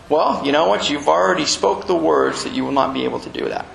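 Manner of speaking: usual speaking voice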